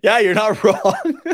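slow words through laughter; laughing. Real, not acted. Laughing while agreeing.

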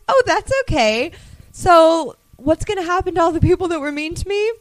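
high, sugary voice with a sly threat; sweet as candy, then threaten; sweet menacing princess. Retold or imitated. Princess sweetly threatening the bullies, high and sugary. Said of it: "And she's like".

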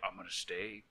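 low, soft, creepy murmur. Retold or imitated. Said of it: "he's just like".

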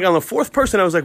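ordinary talk

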